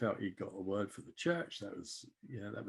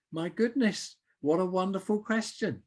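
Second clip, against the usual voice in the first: high, louder burst of delight; delighted exclamation; 10 semitones higher, 6 dB louder than usual. Retold or imitated. Newcomer thrilled by the question of what brought them here, delighted. Reacting to being asked her story.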